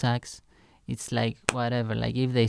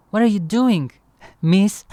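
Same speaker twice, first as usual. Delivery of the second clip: higher, louder nervous question; insecure; 7 semitones higher, 6 dB louder than usual. Retold or imitated. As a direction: blurt it out flustered and unsure. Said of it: "Very insecure".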